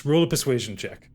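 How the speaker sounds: ordinary delivery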